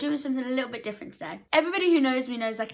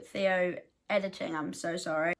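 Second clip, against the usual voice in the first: ordinary delivery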